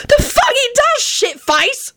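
very high, outraged bellow; furious high retort. A narrator's reading. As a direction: bellow it, pitch shooting right up. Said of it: "Sirius bellowed".

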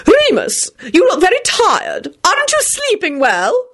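high-pitched, fussy society lady; fussing lady. A narrator's reading. Lady Fanshawe worrying over tired Remus, fussing. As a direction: fuss over him in a high voice. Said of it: "immediately began fussing over Lupin".

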